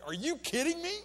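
high, loud incredulous outburst; stunned disbelief. Retold or imitated. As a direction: blurt it in shock, way up and loud. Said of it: "It was everything in me not to say".

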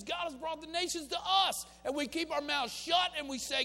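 high, loud pulpit preaching; preaching at full force. Acted. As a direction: preach it out to the congregation, rising. Preaching the nations at our door.

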